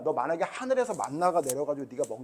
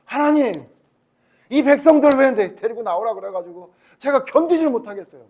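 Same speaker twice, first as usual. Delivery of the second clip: higher, louder pleading complaint to God; Moses complaining; 5 semitones higher, 10 dB louder than usual. Retold or imitated. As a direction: plead like an exhausted leader. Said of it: "모세도 하나님께 원망을 해".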